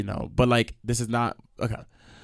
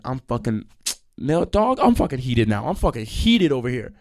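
ordinary talk, nothing marked

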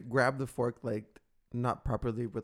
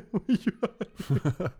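laughing mock; 7 semitones higher, 4 dB louder than usual. Teasing repeat, laughing.

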